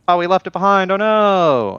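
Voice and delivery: high, loud sarcastic alarm; mock panic. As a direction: fake dismay, hammed up. Mocking their own fake panic.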